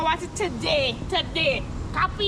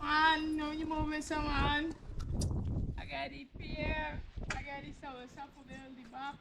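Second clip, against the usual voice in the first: street vendor call; 11 dB quieter than usual. Acted. Vendor calling out wares.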